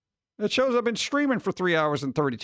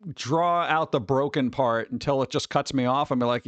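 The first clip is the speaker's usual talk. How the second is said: ordinary talk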